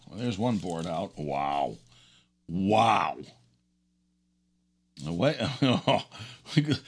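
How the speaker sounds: ordinary delivery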